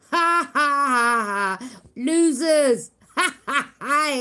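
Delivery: loud, jeering laugh with a shouted "Losers"; mocking laughter. Real, not acted. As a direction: laugh in their faces, loud. Mocking the haters on live.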